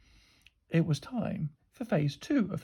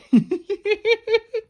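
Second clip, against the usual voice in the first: high, squeaky giggling; kids' tittering they can't hold in; high-pitched snicker; stifled giggles; 7 dB louder than usual. A narrator's reading. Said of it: "stifle their giggles".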